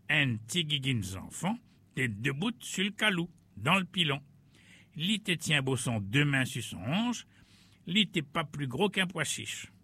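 plain reading voice

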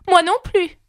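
voix très aiguë et plus forte; un lièvre fantasque qui lance sa réplique; lièvre de Mars. A narrator's reading. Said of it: "dit le lièvre de Mars". (le lièvre de Mars, voix très aiguë)

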